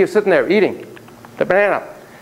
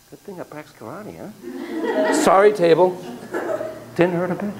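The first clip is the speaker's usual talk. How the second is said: ordinary talk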